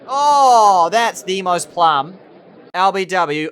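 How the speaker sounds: loud, slowing groan of protest; loud disbelief. Real, not acted. Bemoaning a plum LBW turned down, loud disbelief. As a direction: stretch it out in loud outrage.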